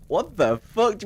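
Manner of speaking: high-pitched baffled reaction; retold disbelief. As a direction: voice jumps up, utterly baffled. Acted stunned reaction to ex's claims.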